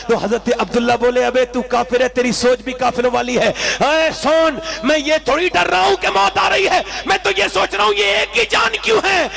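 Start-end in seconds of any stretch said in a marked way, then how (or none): 3.8-9.3 fervent shout. Acted. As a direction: shout it out as the fearless companion. Companion answers the king defiantly.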